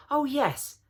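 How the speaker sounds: put-on chorus of thrilled children; high, breathless eagerness; eager voices. A narrator's reading. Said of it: "said several voices at once".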